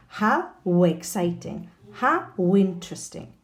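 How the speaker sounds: "how exciting, how interesting" with a strong w glide after each how; linked W sound. Shown as a demonstration. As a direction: slowly link how to the next word with w. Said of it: "a W sound is inserted".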